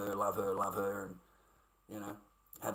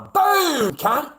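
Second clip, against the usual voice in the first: much higher, loud yelled send-off; triumphant shout; 13 semitones higher, 11 dB louder than usual. Real, not acted. Banning the abusive commenter from the channel, shouting. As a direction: bellow it as you kick him out.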